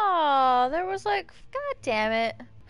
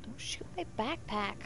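whisper; 8 dB quieter than usual. Real, not acted. Quietly pleading in game.

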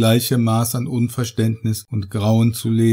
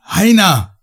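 much higher, louder desperate bellow; bellowing after the vanished friend; 8 semitones higher, 5 dB louder than usual. Acted. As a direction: roar the name into the void. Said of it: "brüllte ich in das flirrende rote Licht".

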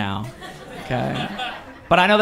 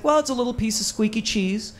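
higher, explaining voice; bartender voice; 8 semitones higher, 3 dB quieter than usual. Retold or imitated. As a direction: play the patient bartender.

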